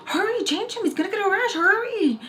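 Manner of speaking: very high, mock-frantic squeal; hammed-up high-voiced hurrying; urgent, trying not to laugh. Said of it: "laugh at the same time".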